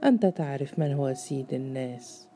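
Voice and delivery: ordinary delivery, nothing marked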